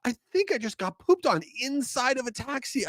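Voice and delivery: replaying the disbelief on the phone call; shocked exclamation. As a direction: caught out by a bird in a taxi, high and shocked. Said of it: "I go".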